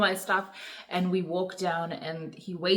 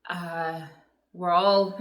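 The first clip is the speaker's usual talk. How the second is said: normal talking voice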